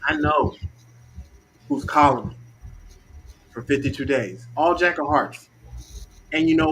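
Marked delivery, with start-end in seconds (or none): none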